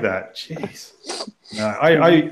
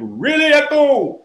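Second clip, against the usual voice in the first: lightly mimicked echo, voice pitched higher; echo effect; 3 dB louder than usual. Shown as a demonstration. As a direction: echo the word back, first call. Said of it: "it's really echoey in here".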